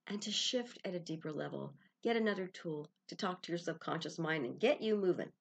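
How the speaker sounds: normal talking voice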